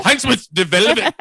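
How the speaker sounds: mock German accent. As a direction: fumble through a made-up German accent. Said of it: "do a German accent".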